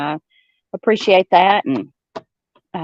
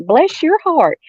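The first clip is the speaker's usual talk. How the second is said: "Bless your heart" sung out higher, sweetly mocking; sarcastic bless your heart; 10 semitones higher, slower than usual. Shown as a demonstration. Showing friends the not-good way, mock-sweet and higher. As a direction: pitch it up high and sugary to cut. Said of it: "I mean that in a not good way".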